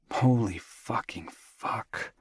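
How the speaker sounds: slow stunned whisper. Real, not acted. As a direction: breathe it out in shock. Stunned inner reaction.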